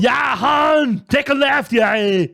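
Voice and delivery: high, loud wookiee-like yell; Chewbacca impression. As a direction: bellow it like Chewbacca. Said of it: "It makes me laugh every time".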